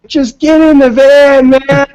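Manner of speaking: high, loud, slow urgent yell; hammed-up older guitarist pleading; mock yell. Older guitarist yelling at bandmates to get in the van. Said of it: "he was like".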